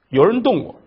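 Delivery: ordinary delivery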